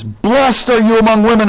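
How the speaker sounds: imitated joyful cry, pitched well up. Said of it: "cries out with a loud voice".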